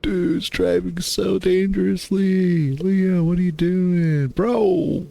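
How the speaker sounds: high, slow alarmed cry; panicked. Acted. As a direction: plead in disbelief, voice up high. Leo driving dangerously, she panics.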